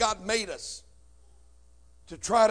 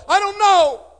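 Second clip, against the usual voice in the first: even higher, strained and loudest; breaking desperate cry; 16 dB louder, slower than usual. Retold or imitated. [name] answering his brother again, voice cracking. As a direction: let the voice crack in helpless panic. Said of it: "I said".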